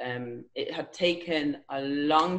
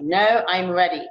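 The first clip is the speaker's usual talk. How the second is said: determined; 3 semitones higher, 6 dB louder than usual. Retold or imitated. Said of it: "I was like".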